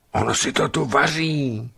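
high, alarmed stage whisper with voice; horrified whisper. A narrator's reading. Explorer discovers a steaming pot, horrified. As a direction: gasp it out in horror, pitched high. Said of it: "šeptám zděšeně".

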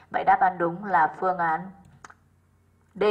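ordinary delivery

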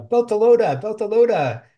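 high, loud chant of Beltalowda; Belter rallying cry. Retold or imitated. Jala proudly hailing her Belter crew. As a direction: call it out proudly.

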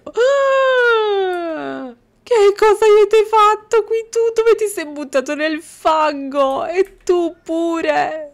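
high, loud horrified outburst; horrified shouting. Real, not acted. Gamer shrieking at children covered in mud, high and loud.

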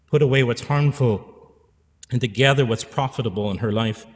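usual speaking voice

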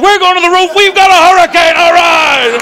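high-pitched, loud whooping announcement; rally your buddies for a hurricane party; hammed-up reveller's cheer; rowdy party excitement. Acted hurricane party in New England.

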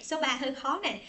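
ordinary talk

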